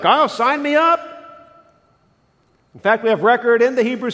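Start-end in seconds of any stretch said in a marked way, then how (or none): 0.0-1.0 higher, louder mock eagerness; eager Jonah. Pastor imagining Jonah volunteering, eager and loud. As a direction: play Jonah jumping at the job. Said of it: "I imagine Jonah be like".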